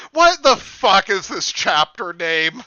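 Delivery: slow, loud, disbelieving; incredulous loud outburst. Real, not acted. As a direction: shout the question in disbelief. Reacting to absurd title.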